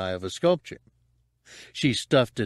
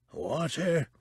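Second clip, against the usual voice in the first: higher, husky plea; ghastly husk; 7 semitones higher, 4 dB quieter than usual. A narrator's reading. Tortured man begs for a drink, husky pleading. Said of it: "pleaded in a ghastly husk of a voice".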